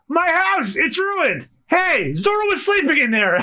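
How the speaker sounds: high-pitched, horrified outcry; upset shouting. Acted. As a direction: cry out over the wreckage. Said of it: "why he was upset".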